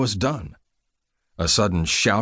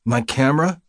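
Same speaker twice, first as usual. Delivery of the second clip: higher, rising demand; insistent; 6 semitones higher than usual. A narrator's reading. Injured photographer demanding his camera from the nurse, insistent. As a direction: press the question, pitched up. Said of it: "I insisted".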